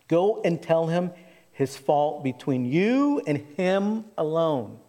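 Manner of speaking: usual speaking voice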